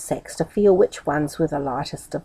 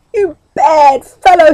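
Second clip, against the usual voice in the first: angry shout; 8 semitones higher, 10 dB louder than usual. A narrator's reading. Grocer shouting at Meddle.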